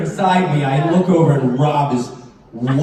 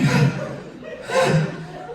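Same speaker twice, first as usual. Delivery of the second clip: panicked breath. Retold or imitated. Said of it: "like, I'm doing this".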